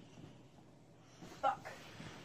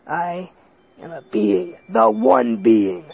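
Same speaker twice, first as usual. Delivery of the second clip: much lower, slow godlike voice; ominous being; 8 semitones lower, 7 dB louder, slower than usual. Acted. Strange being answering the boy, low and slow. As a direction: intone it like an ancient deity. Mysterious being introduces itself.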